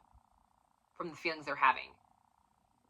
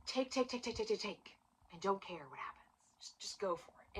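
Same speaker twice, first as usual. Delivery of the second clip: indulgent hush; 13 dB quieter than usual. Pretend. Acting out Roman decadence.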